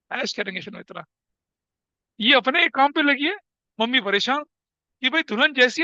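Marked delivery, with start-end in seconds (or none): 0.1-1.0 sly cat voice. Acted. Cat gloats to its friend over the sweets, soft and sly. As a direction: murmur it like a smug cat.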